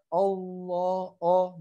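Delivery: "Allah" started with a rounded O, like "Olloh"; wrong O vowel. Shown as a demonstration. Teacher shows students what to avoid, "Allah" with opening O. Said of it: "Maka jangan dibacanya Allah".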